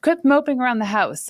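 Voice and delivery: lightly voiced mom telling her to quit moping; mom scolding. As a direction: nag like a fed-up mom. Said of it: "as my mom said".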